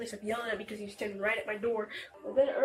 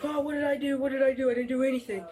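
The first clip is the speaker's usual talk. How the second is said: higher, louder imitation of indignant denial; mock innocent protest; 4 semitones higher, 5 dB louder than usual. Youth imitating the man who pesters them, mock innocent. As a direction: act out someone loudly pleading innocence. Retells his claim of innocence.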